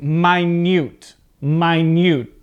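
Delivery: minute as my-NYOOT, each time clearly separated; adjective pronunciation. Shown as a demonstration. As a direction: say minute like "my newt". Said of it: "So as an adjective".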